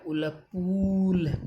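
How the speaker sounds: "उल, पूल" blended very slowly, long "ऊ" stretched; teacher blending the sounds into "पूल". Shown as a demonstration. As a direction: build the word sound by sound, slowly. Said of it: "उसके बाद जैसे पै".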